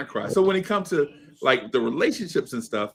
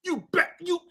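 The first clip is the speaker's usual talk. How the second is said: stern warning; 9 semitones higher than usual. Retold or imitated. Warning his father not to cry.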